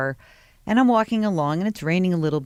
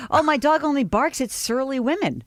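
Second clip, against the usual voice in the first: sassy comeback; 11 semitones higher than usual. Pretend. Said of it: "things I could have said".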